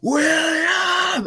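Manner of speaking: a high, held cry of William!; mock battle cry, high and sustained; battle shout. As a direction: hurl the name out as a war cry, high and long. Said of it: "you just hear him shout".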